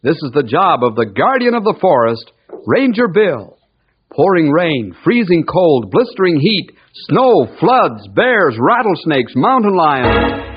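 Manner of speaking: put-on punchy, rolling old-time show intro; dramatic radio announcer. Announcer introducing the guardian of the forest, dramatic. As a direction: announce each danger with ringing emphasis.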